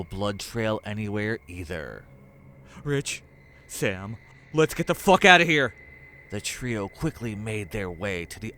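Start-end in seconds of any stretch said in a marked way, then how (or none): 2.8-5.7 higher, loud and fast urgent yell; loud rushed panicked escape call; panicked shout. Acted. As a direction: shout them toward the exit. Fleeing after monster howl.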